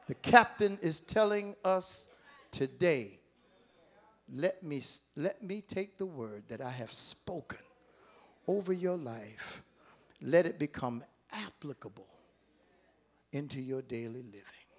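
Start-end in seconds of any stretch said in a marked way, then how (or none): 4.3-14.7 quiet. Acted. Voicing God softly.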